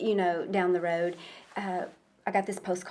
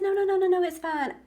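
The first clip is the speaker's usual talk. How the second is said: high, louder rush of no no no; hurried reassurance; 11 semitones higher, 4 dB louder than usual. Retold or imitated. She reassures the volunteer in a higher, louder rush. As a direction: brush it off quickly, pitched up. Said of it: "And I said".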